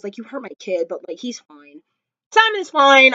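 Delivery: ordinary talk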